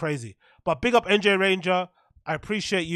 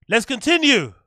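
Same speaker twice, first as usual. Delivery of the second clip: louder, higher announcement; rousing call to resume; 4 semitones higher, 8 dB louder than usual. Real, not acted. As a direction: call everyone back with a burst of energy. Calls the stream back to order.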